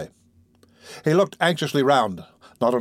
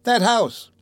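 put-on high, urgent boy; alarmed cry; 11 semitones higher than usual. A narrator's reading. Oliver points out the house, crying out high. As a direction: blurt it out in alarm. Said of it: "cried Oliver, pointing out of the carriage window".

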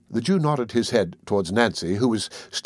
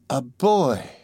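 drawn exclamation in a put-on old man's voice; exclaiming; 3 semitones higher than usual. A narrator's reading. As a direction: exclaim it with surprise.

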